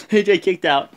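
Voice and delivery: usual speaking voice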